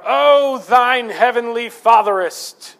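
put-on high, loud, slow pompous old-English prayer voice; mock King James prayer. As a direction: ham up a lofty churchy prayer. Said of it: "break out in the King James English".

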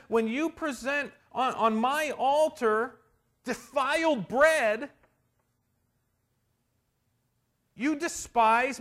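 loud, high fervent sermon voice; full-force preaching. Acted. Preacher thundering about the defiled bread to the congregation. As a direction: hammer each line to the congregation.

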